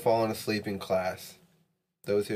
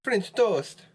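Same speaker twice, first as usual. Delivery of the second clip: high, sing-song mock Italian accent; 9 semitones higher than usual. Player protesting the rule to a friend in a mock Italian accent. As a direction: put on a hammy Italian accent, pitched well up. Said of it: "speak with an Italian accent".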